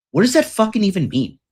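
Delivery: a rich friend who can't even parse it; baffled friend. Retold or imitated. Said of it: "He's like".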